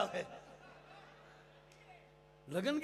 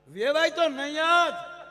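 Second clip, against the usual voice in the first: sudden loud, slow, higher jab; loud punchline; 6 semitones higher, 10 dB louder, slower than usual. Acted. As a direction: slam the punchline home slowly. Punchline shouted after joke. (જોરથી ધીમો ઠપકો)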